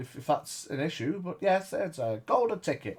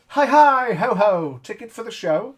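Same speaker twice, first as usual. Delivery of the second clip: high, loud sing-song clown greeting; 8 semitones higher, 9 dB louder than usual. Acted. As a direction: play a chirpy circus clown. GM voicing clown at ticket booth.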